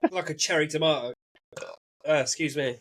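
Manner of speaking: usual speaking voice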